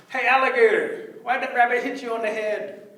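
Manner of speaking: high, loud put-on voice; disguised rabbit. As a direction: call over in a small person's voice. Disguised rabbit calls alligator.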